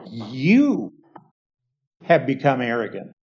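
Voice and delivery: "You" punched, then a pause before "have become arrogant"; stress on YOU. Shown as a demonstration. Preacher showing Paul's emphatic "You" to the Corinthians, stressed. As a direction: land hard on "You", then rush the rest. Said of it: "it's very emphatic in the Greek".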